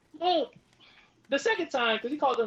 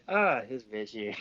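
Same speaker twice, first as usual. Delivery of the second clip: exasperated mutter; 9 semitones lower, slower than usual. Retold or imitated. Annoyed thought about teacher.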